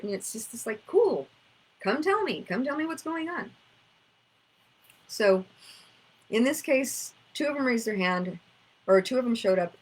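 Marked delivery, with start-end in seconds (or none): 0.9-3.5 coaxing invitation. Retold or imitated. Inviting inner child parts to speak.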